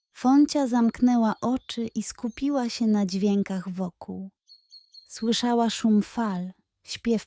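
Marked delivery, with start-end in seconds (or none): none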